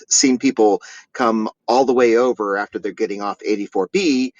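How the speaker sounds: ordinary talk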